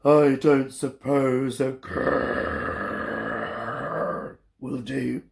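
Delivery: low, very slow bear voice; grizzly bear. Acted. Grizzly bear asking Olive, in a deep rumble. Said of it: "asked the great big grizzly bear".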